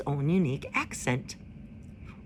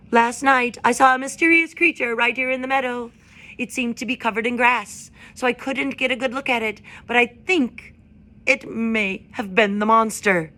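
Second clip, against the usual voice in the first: higher, louder owl addressing a crowd; hooting owl; 6 semitones higher, 6 dB louder than usual. A narrator's reading. Owl calling the meadow crowd to order, higher and louder. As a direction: hoot it out from the branch. Swooper voiced continues.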